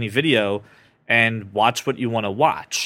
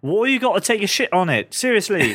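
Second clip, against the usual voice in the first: mock British accent; 8 semitones higher than usual. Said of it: "You can't even yell at me without it sounding, like, really nice and polite".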